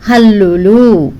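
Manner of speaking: హల్లులు drawn out slowly and loudly, like a heading on the board; announcing the heading. Shown as a demonstration. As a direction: announce హల్లులు slowly and firmly for the class to repeat. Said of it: "పిల్లలు అందరూ నాతో పాటు మీరు కూడా చెప్తూ ఉండండి".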